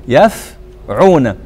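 يفعون very slowly, pause after the first syllable; split into syllables. Shown as a demonstration. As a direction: break يفعون in two, "yaf... ʕuna". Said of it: "يدعون على وزن".

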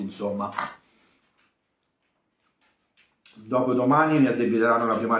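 muffled words through food; talking while chewing. Real, not acted. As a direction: hand over full mouth. Seen 0: Bite at -3.0, then hand covers the mouth while speaking.

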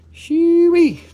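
high, loud gleeful whoop; excited whoop. Real, not acted. Seller whooping at a glassy aquamarine, thrilled and loud. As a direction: let it burst out, thrilled.

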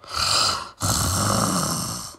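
low rumbling snore; snore sound. Acted. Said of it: "went Missus Bear".

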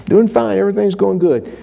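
hammed-up carefree reply; mock complacent listener. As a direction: mimic the self-satisfied churchgoer. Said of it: "Oh, you say".

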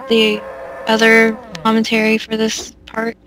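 ordinary delivery

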